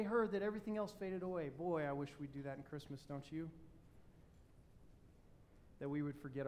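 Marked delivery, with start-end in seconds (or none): none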